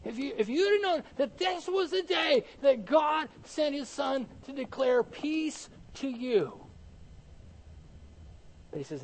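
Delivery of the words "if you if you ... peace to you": high, raised outcry, slowing at the end; preacher's anguished exclamation; impassioned cry. Acted. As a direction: cry it out as a pleading lament. Preacher voicing Jesus's anguished plea.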